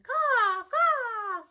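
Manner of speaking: loud, high mock crow caws; grandma faking bird calls; crow caw imitation.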